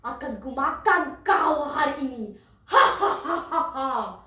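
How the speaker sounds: slow, gloating villain with big laughs; menacing crocodile. Acted. Crocodile corners the duck to eat it, slow menace. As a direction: taunt the prey slowly, laughing between threats. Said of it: "Begitu kata buaya".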